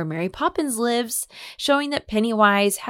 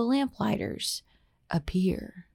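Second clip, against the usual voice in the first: spooky whisper; 2 semitones lower, 7 dB quieter, slower than usual. Acted. Spooky reveal of vanished lamplighters.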